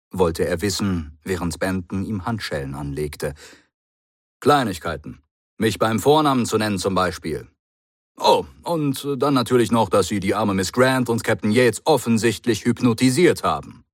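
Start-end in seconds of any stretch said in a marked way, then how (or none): 4.4-13.8 lively, raised character voice for the Doctor; brisk clever hero, a bit pleased with himself; the Doctor, voiced higher. The Doctor explains to the Brigadier, lively and higher.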